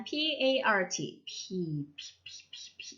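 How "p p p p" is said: P as tiny voiceless puffs of air; bare P sound. Shown as a demonstration. Teacher finding the sound inside the letter's name, voiceless P puffs. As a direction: pop P softly with breath only. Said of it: "이름 안에 P".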